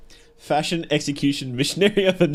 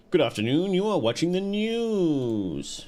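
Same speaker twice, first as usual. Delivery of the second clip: slow, higher announcer read; mock news anchor; 4 semitones higher than usual. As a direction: play a TV newsreader opening the bulletin.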